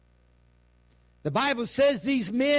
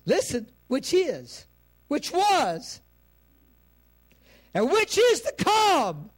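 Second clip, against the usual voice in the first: starting slow and rising to a loud, high cry; building preaching cry; 6 semitones higher, 4 dB louder, slower than usual. Acted. Preacher to church, building from steady to a loud high cry.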